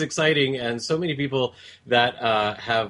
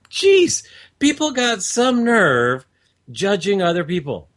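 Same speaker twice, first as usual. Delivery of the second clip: exasperated; 5 semitones higher, 4 dB louder than usual. Retold or imitated. Exasperated inner thought.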